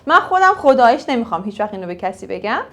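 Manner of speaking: normal talking voice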